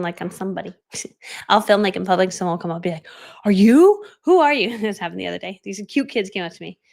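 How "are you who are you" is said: high, loud mock astonishment; imitated amazed stranger. Stranger approaches her filming in public, mock amazed. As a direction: play a curious onlooker, pitch jumping up.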